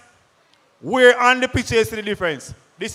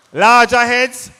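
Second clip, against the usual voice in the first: loud called answer; calling out; 3 semitones higher, 8 dB louder, slower than usual. Real, not acted. Presenter answering his own quiz, loud call. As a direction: shout it out for the crowd.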